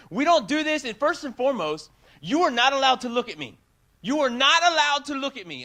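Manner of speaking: a mock outraged order; imitated angry yell. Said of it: "she's yelling and she's screaming".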